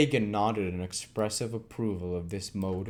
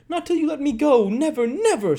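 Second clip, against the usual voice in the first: even higher, louder, strained scream; piercing scream; 8 dB louder than usual. Acted. Nancy screaming her refusal, piercing. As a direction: scream it past breaking point. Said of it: "screamed the girl".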